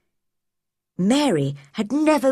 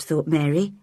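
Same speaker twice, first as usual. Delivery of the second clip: usual speaking voice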